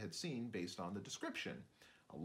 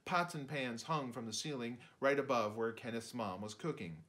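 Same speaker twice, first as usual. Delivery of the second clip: usual speaking voice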